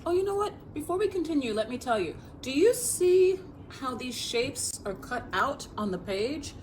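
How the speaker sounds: usual speaking voice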